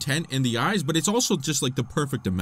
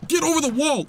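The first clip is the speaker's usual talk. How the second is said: yelling; 13 semitones higher, 4 dB louder than usual. Real, not acted. Urging during attack.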